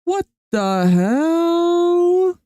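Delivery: long, drawn-out high "What the hell"; stunned disbelief. Real, not acted. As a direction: stretch it out, totally baffled. Stunned by the robot video.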